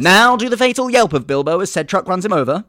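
loud, high demand; loud customer. Acted. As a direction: bellow the order.

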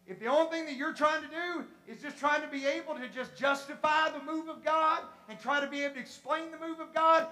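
loud, rising sermon climax; full-force preaching. Real, not acted. Preacher urging the church to experience God, building to a shout.

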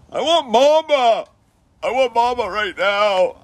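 put-on high, whiny kid plea; whining for mama. Kid crying for his mother, high whiny plea. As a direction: wail for mama like a little kid. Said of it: "it's like".

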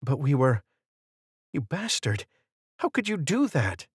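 betrayed, fighting tears as she accuses him; tearful. A narrator's reading. Betrayed girlfriend confronting John, voice high and tearful. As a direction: voice rising high and tight with held-back tears. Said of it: "could hear the tears in Michelle's voice".

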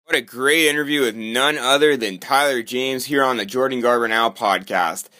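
podcast host outro. Acted. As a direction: wrap up like a radio host.

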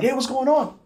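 high, chipper hey; chipper greeting. Retold or imitated. Quoting his chipper Gunny greeting, high and bouncy. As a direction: toss it off bright and cheery. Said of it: "chipper like he always is".

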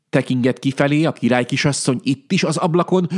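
ordinary delivery, nothing marked

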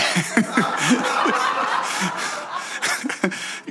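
soft unvoiced laughter fading out; breathy laugh. Real, not acted. Preacher still laughing after the joke, soft breathy laugh. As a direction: keep chuckling quietly.

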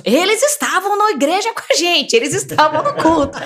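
half-hearted retold yell of a frantic mother; mother yelling to the police, a mild mock shout; mother shouting, imitated. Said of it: "minha mãe gritando pros sete cantos".

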